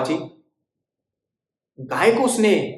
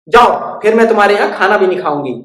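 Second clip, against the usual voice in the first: loud offended bird; huffy sulk; 4 semitones higher, 9 dB louder than usual. Acted. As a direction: storm off in a huff. Bird storming off.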